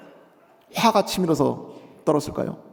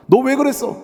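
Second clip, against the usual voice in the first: mock reproach; 8 semitones higher, 3 dB louder than usual. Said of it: "추궁도 하고 속풀이라도".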